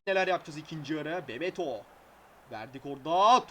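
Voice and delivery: ordinary delivery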